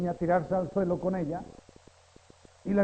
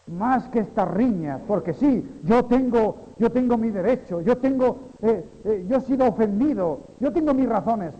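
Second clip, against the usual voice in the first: loud, higher insistence on his rights; indignant self-justifying; 5 semitones higher, 6 dB louder than usual. Retold or imitated. Preacher mimicking an offended brother, loud and indignant. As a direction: hammer "yo tengo" like an offended man. Voicing a grudge-holding believer.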